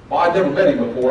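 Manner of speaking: usual speaking voice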